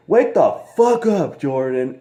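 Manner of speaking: high, hammed-up yell at her husband; mock angry wife. Wife waking her husband with water, mock high yell. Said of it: "she's all like".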